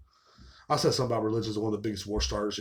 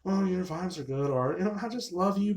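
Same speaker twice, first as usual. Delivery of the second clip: higher-pitched mock sweet-talker; woman letting him down; 7 semitones higher than usual. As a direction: imitate someone letting you down, pitched up. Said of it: "Instead of like".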